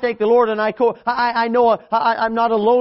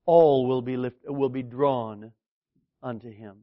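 hushed; 8 semitones lower, 5 dB quieter than usual. Real, not acted. Sermon climax, soft.

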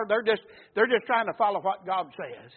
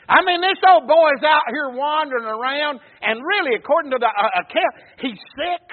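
raised, driving pulpit delivery; fervent preaching; 6 semitones higher, 10 dB louder than usual. Real, not acted. Preacher describes the lost boy to his congregation, fervent preaching. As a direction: preach it out loud and urgent.

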